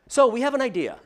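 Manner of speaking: high, loud call to the whole room; loud announcement. Retold or imitated. Partner announcing the idea to a room of executives, loud and high.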